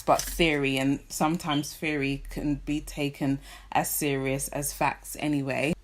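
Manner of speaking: normal talking voice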